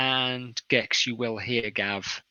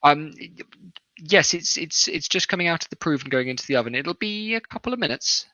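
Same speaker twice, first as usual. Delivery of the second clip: put-on higher-pitched, hesitant young woman; 7 semitones higher, 5 dB louder than usual. Kitchen woman explaining the bread, young woman's voice. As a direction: answer as a young serving woman, voice lifted. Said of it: "a young woman".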